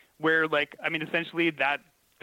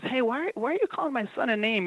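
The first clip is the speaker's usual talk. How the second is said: much higher, indignant parent challenge; mother confronting the kindergarten teacher, higher pitched; confronting mom; 8 semitones higher than usual. Retold or imitated. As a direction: demand it like a protective mother.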